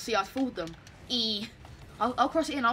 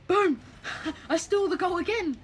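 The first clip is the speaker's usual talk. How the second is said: louder and higher, gleeful boast; triumphant shout; 7 semitones higher, 4 dB louder than usual. Real, not acted. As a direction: crow over the stolen goal. Celebrating a stolen goal.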